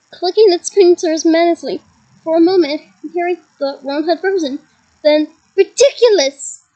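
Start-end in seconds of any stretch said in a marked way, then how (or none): 5.6-6.6 bellowed spell. A narrator's reading. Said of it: "bellowed Ron".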